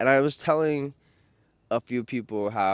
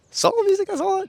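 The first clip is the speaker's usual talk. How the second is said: much higher, louder emphatic tone; singsong emphasis; 6 dB louder than usual. Real, not acted. Vlogger gushing about soul music, singsong high. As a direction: sing it out with emphasis.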